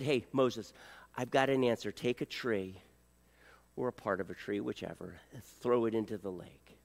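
low, soft, calm voice of God. Pretend. God quietly giving Moses the answer, low and calm. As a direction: speak down low and gently as God.

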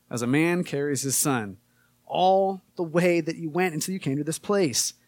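loud, slow scripture read to a congregation. Acted. Pastor reading Deuteronomy aloud to the church, in a proclaiming voice. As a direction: proclaim the verses from the pulpit.

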